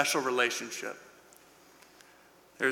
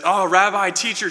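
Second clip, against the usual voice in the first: higher, louder retold cry of recognition; joyful recognition; 5 semitones higher, 6 dB louder than usual. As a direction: burst out as if recognizing a beloved teacher. Mary recognizes the risen Jesus.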